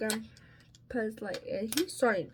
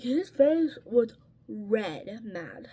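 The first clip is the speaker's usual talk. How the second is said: normal talking voice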